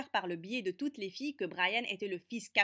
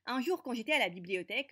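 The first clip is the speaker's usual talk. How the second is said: ordinary delivery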